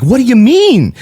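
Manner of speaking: high-pitched retold indignation; aghast. Writer challenges the father-in-law, aghast. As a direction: act out shocked disbelief, pitch jumping up. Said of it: "Now I'm aghast at that point".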